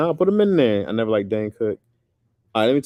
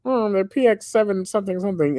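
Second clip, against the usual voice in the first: higher put-on cartoon alien voice, lightly done; Marvin the Martian impression; 13 semitones higher, 3 dB louder than usual. Comedian at the Laugh Factory shows off, cartoon Martian voice. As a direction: retell a comedian's squeaky cartoon Martian bit. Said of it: "I do a great Marvin the Martian impression".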